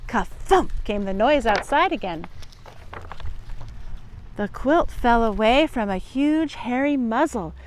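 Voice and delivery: ordinary delivery, nothing marked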